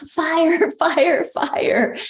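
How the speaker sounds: high, loud repeated cry of fire; imitating a frantic yell; retold yell. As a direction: shout it out like a man in panic. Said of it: "Robert does ... is yell".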